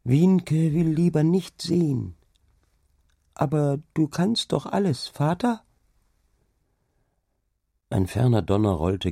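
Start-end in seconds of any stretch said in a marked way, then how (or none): 0.0-5.6 put-on high, timid small child; trembling little voice. A narrator's reading. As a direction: plead in a tiny anxious voice. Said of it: "ein zitterndes Stimmchen". (zartes, ängstliches Kinderstimmchen)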